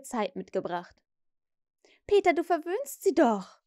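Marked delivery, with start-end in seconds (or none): 2.1-3.6 higher, lightly voiced Wendy with a shy smile; Wendy chides Peter with an uncertain smile; unsure smile. A narrator's reading. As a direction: tease him gently but uncertainly. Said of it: "lächelte unsicher".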